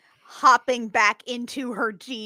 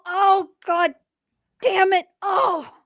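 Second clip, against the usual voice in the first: frustrated; 9 semitones higher, 3 dB louder, slower than usual. Acted. Frustrated outburst.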